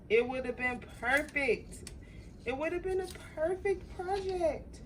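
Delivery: high, disappointed wail; dismayed lament. Real, not acted. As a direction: mourn what could have been, pitch rising. Lamenting her ruined project.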